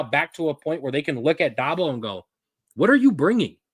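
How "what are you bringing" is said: louder, higher mock demand; demanding challenge. Clemson fans confronting Dabo, loud demanding question. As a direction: voice a fed-up fan base.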